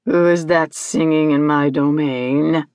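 low, imperious creature voice; horrid demanding voice. Acted. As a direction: demand it low, like a menacing ruler. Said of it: "the most horrid voice she had ever heard".

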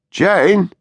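high, loud call up the stairs. A narrator's reading. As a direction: call her name into the dark house. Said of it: "Larry called".

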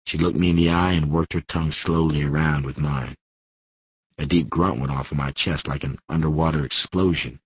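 plain reading voice

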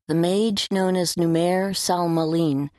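plain reading voice

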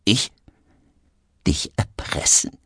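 near-whispered feigned surprise; suddenly calm. A narrator's reading. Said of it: "plötzlich wieder ganz ruhig".

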